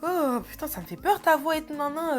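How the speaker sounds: retold, goofy mock fright; acting silly. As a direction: play the fool, pretending her voice scares you. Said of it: "je fais la conne et tout".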